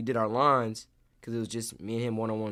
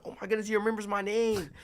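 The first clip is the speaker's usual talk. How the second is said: high, starstruck delight; high-pitched gushing retelling; excited; 10 semitones higher than usual. Retold or imitated. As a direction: relive a fan's thrill. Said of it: "I was just so excited to see him".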